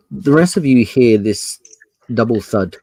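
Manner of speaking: usual speaking voice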